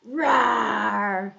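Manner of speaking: big rough lion's roar. Shown as a demonstration. Teacher shows children the story cue, big lion roar.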